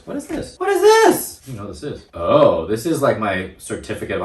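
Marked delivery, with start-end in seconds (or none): 0.0-0.6 very high, soft little squeak; squeaky mock wonder. Playful surprise at booklet find. 0.6-1.5 very high, loud playful squeal; squeaky mock amazement. Repeats the surprise, louder.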